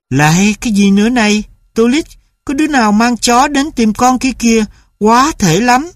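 an annoyed mother shouting into the house; irritated mother. A narrator's reading.

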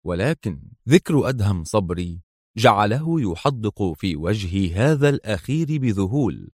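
plain reading voice

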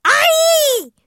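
high, loud yelp; pained scream. A narrator's reading. Darla hit on the head, high loud scream. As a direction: shriek of pain. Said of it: "صرخت دارلا".

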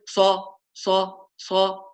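صا said softly and limply; weak sad. Shown as a demonstration. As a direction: let صا fade, weak and unclear. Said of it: "تنطق الصاد ضعيفة".

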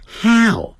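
"how" slow, starting on ha and gliding closed; English how. Shown as a demonstration. Teacher contrasts Chinese hao with English how. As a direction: stretch "how" from an open ha. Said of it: "他不是念好".

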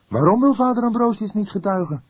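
the junior partner admitting the autopsy got to him; young detective voice. Acted.

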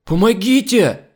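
high, loud, drawn-out cry; wailing for help. A narrator's reading. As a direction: scream for help in panic. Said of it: "Вопль мигом подхватили". (громкий вопль о помощи)

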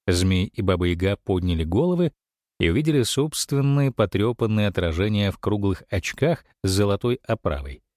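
plain reading voice

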